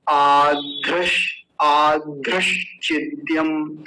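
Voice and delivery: आध्रश चिद्यम slowly, with long आ and breathy aspirated ध; model pronunciation. Shown as a demonstration. Teacher showing students how the joined word sounds, आध्रश चिद्यम drawn out slowly. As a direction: draw out आध्रश चिद्यम syllable by syllable. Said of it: "कुछ लोग इसका गलत उच्चारण करते हैं".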